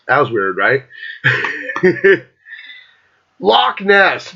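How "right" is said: normal talking voice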